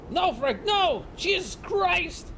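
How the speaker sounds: high, drawn-out cry of horror; acted shock and grief; anguished cry. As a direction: scream at losing your partner. Friend watches partner die.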